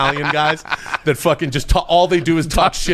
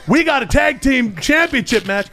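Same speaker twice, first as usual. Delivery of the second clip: high, shouting wrestling manager promo; brash manager yelling a promo; Bobby Heenan promo; 11 semitones higher than usual. Retold or imitated. Impressionist cutting a Bobby Heenan promo, high and brash. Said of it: "Bobby Heenan cuts a promo like".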